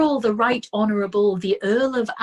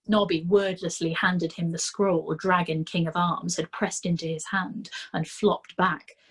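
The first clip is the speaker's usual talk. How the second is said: usual speaking voice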